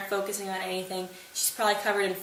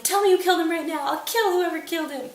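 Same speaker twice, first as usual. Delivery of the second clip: high loud vengeful demand; furious demand; 10 semitones higher, 6 dB louder than usual. Retold or imitated. Laertes to the king, loud furious demand. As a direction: bark the order in rage.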